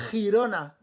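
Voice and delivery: Girona with a throaty rasped G like a J; Girona, G scraped in the throat, Spanish style; Spanish G before I. Shown as a demonstration. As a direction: rasp the back of the throat on the G of Girona. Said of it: "Là, il faut te racler la gorge".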